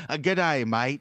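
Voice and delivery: loud hammed-up Aussie greeting; Australian accent. Second try at the cohost's request, loud bad Australian accent. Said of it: "I can't do an Australian accent".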